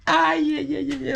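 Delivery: high mock-emotional wail. Getting emotional over dandelions, mock high wail. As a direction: wail as if overcome by the song. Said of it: "I'm getting emotional".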